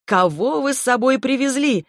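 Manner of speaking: bright, gleeful cry, rising high; cheerful exclamation. A narrator's reading. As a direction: call out, laughing at the sight. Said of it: "весело воскликнул он".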